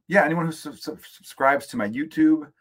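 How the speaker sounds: stutters on "sub-, sub-, subscribes"; stuttering glitch. Real, not acted. Host thanking YouTube subscribers, stuttering on "subscribes". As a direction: catch on "subscribes", repeating its first syllable like a glitch. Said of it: "glitch there".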